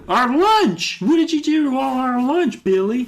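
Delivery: high, loud, indignant character voice; Alex. Acted. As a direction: wail the complaint in a high cartoonish voice. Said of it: "Alex comes back and he's like".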